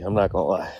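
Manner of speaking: ordinary delivery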